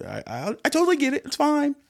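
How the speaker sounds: high falsetto, quick reassurance; playful squeaky concession; mock understanding. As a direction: act out the forgiving inner voice, squeaky and high. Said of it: "the other part is like".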